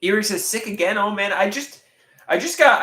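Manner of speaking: ordinary delivery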